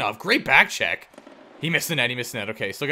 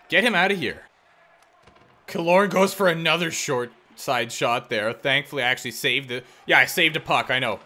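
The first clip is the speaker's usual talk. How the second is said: normal talking voice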